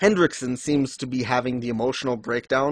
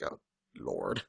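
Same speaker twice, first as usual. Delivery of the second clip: deep exasperated groan; 15 dB quieter than usual. Retold or imitated. Exasperated reaction to Diane.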